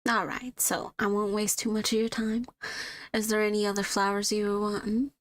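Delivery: easy small talk with a hint of southern twang; light southern twang. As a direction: florist chatting with a tired customer, light southern twang. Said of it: "slightly giving a southern twang to her".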